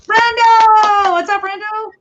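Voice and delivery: loud high greeting; calling out. Real, not acted. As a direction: call out across the room. Greeting friend arriving.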